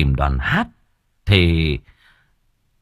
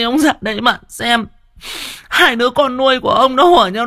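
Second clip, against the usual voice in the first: hammed-up wailing; 3 dB louder than usual. A narrator's reading. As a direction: wail theatrically so the whole house hears. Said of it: "ngoạc mồm ra gào".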